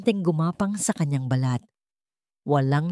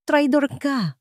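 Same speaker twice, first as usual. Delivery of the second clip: high, tearful cry of betrayal; tearful shout; 8 semitones higher than usual. A narrator's reading. Wife screaming at her husband in tears. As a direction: scream it at him through tears. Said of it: "sigaw niya, kasabay ng pagpatak ng kanyang mga luha".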